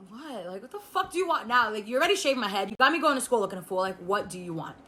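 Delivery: put-on sulky teen muttering back, fast; muttered retort. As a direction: gripe quietly so mom won't hear. A teen grumbling at a parent's call.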